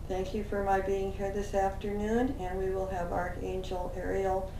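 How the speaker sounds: ordinary delivery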